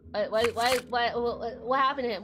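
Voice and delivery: usual speaking voice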